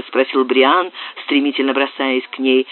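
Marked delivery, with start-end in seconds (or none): none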